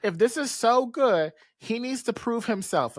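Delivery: a high, sugary fake okay; mock sister. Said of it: "her sister's just like".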